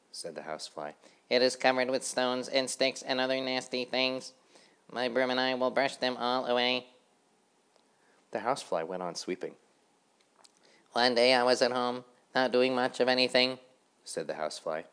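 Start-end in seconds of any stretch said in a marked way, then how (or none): 1.3-6.9 high busy housefly chanting; sing-song of a tidy little fly. A narrator's reading. Housefly sweeping the road, chanting brightly and high. 10.9-13.6 high busy housefly chanting; sing-song of a tidy little fly. A narrator's reading. Housefly sweeping the road, chanting brightly and high.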